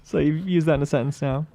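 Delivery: ordinary delivery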